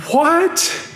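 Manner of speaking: mock-shocked, very high-pitched "What?"; incredulous exclamation. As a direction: let the voice leap up in disbelief, imitating a stunned professor. Professor's shocked reaction, retold.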